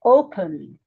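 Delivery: "Open" with a firm, low "O", falling away; stress on first syllable. Shown as a demonstration. Teacher repeating the example for learners, stress on "O" in "Open". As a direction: lean on the "O" in "Open". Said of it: "is on the first syllable".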